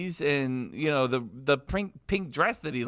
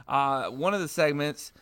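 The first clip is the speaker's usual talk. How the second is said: ordinary delivery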